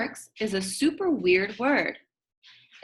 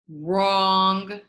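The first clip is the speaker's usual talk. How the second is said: emphatic wrong; 4 dB louder than usual. Acted. Answering his own question.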